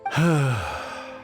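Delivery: long, heavy sigh; despairing sigh. Acted. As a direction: sigh in defeat. Old man in despair.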